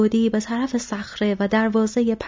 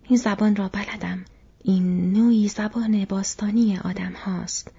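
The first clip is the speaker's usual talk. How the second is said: usual speaking voice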